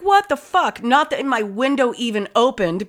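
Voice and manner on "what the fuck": retold jolt, pitched well up; roused by a saxophone at night, startled. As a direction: jump awake at the noise, shocked. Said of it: "I was like".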